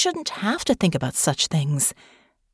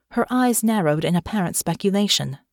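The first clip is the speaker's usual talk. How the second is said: usual speaking voice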